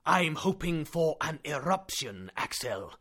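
slow, measured; calm hope. Acted. Uncle calm.

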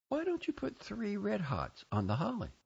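high-pitched motherly directions; high, fussy mother voice; mother's voice. A narrator's reading. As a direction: instruct a little girl in a high voice. Mother giving directions to child.

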